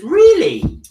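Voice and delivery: high, loud really; genuinely amazed; surprised. Real, not acted. Host reacting to a viewer's comment, high surprise.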